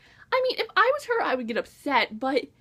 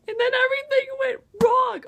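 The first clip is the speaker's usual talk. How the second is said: loud, high exclaim of plot doom; raised voice, theatrical dismay; dramatic outburst; 10 semitones higher, 4 dB louder than usual. Real, not acted. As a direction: cry it out like a disaster.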